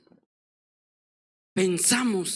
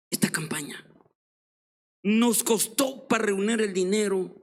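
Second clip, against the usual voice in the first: tearful plea; 2 semitones lower than usual. Retold or imitated. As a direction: confide the money troubles almost in tears. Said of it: "se empezó a llorar".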